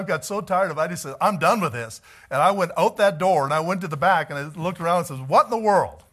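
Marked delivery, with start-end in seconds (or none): 1.2-2.0 fed-up outburst. Retold or imitated. Fed up with night disturbances. 5.2-6.0 loud and high, a shouted "what in the world"; retold shout from the back door; startled shout. Checking outside in the night.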